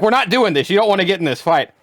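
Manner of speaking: loud, indignant retort; retold heated warning. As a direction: shut the argument down, loud and high. Acted warning in retold argument.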